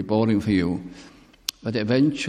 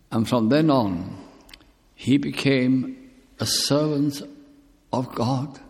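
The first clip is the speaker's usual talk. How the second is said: ordinary talk, nothing marked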